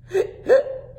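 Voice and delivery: sharp high gasping hiccup intake. Acted. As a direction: gasp in like a hiccup. Hiccup gasp.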